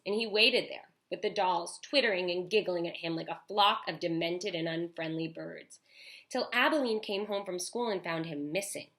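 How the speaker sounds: usual speaking voice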